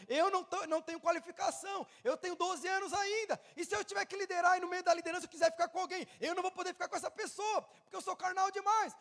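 high, loud, desperate begging; loud pleading. Acted. Preacher as Moses begging God to send another, loud high pleading. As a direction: beg at full force, piling up excuses. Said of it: "Moisés pediu".

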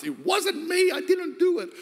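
high, yelped mock denial; Sarah's denial. Sarah protesting to the Lord in a high yelp. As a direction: squeal it like a guilty kid. Sarah denies laughing, voiced.

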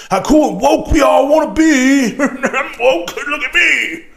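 mock show-off whine. Mocking those who want to be woke, sing-song mockery.

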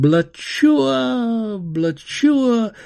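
high, loud explosion call; firework boom. Acted. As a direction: burst it out like fireworks.

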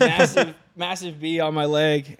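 loud, slow emphasis on massive; big proud announcement; emphatic massive. Real, not acted.

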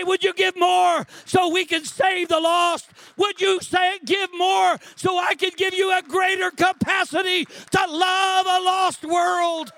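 high, loud pleading shout; crying out as God. Retold or imitated. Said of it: "He's gonna cry unto you".

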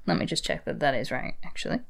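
ordinary talk

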